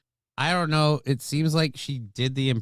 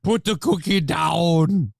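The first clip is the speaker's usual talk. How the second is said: mock Arnold; 5 semitones higher, 4 dB louder, slower than usual. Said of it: "bringing Arnold Schwarzenegger in it".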